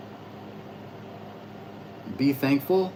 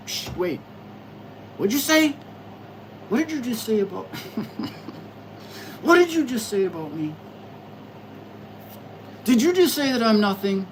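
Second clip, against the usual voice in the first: higher, indignant "what did you say"; talking back to her own thoughts, indignant and higher; mock confrontation; 7 semitones higher than usual. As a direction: confront the voice in your head, offended. Arguing with her own mind.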